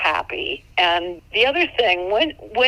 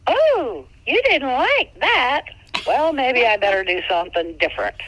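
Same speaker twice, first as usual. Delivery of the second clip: soothing a startled horse with a silly high voice; 7 semitones higher than usual. Shown as a demonstration. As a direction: coo at the animal in a goofy high voice. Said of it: "don't be afraid to talk in a sillier voice".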